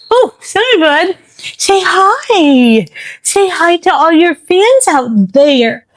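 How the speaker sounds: high, sing-song voice to the dog; pet talk. Real, not acted. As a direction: coax the dog sweetly. Talking sweetly to the dog.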